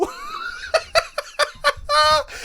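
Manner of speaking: loud, very high-pitched manic cackle; shrieking laugh in a long run. Real, not acted. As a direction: cackle helplessly at the top of your voice. Delighted by his absurd combo.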